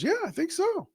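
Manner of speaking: high-pitched mock of an eager yes; mocking Rodgers. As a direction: toss it off in a silly high voice. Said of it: "And Aaron Rodgers goes".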